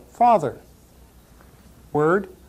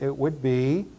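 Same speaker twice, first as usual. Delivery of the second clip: ordinary talk, nothing marked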